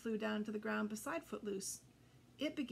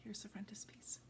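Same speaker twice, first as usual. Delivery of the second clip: whisper; 2 semitones lower, 9 dB quieter than usual. Real, not acted. Aside while showing book.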